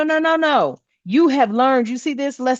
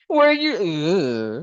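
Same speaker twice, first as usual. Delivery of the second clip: ordinary talk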